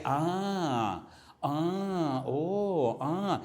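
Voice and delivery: drawn-out "ah" and "oh" of dawning understanding; acted realization "ah". Believer whose mind is illuminated, acted realization. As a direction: act out things becoming clear, in long ahs. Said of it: "Y usted dice".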